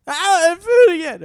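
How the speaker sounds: put-on the baby suddenly cries for food; hungry baby wail. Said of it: "he goes".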